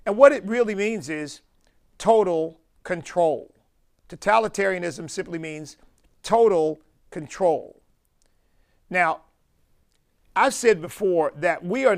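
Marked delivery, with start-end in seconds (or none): 2.0-3.5 total control, slowed and leaned on hard; stress on total. Acted. Preacher defining the term for the congregation, emphatic stress on total. 6.2-7.8 total control, slowed and leaned on hard; stress on total. Acted. Preacher defining the term for the congregation, emphatic stress on total.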